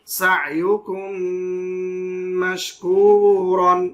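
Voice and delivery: "সা ইউ কুম মাশকুরন" slow, with a long hummed meem; ghunna on meem. Shown as a demonstration. Quran teacher showing idgham, slow nasal ghunna. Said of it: "গুণনা করে পড়লাম".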